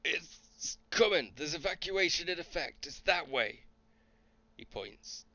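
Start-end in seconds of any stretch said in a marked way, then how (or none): 0.0-3.6 high, loud soldier's warning; urgent calling out. Acted. Soldier warning of a giant monster coming, urgent calling out. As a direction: call it out tensely from a distance.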